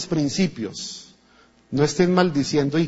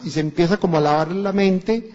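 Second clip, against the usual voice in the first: ordinary delivery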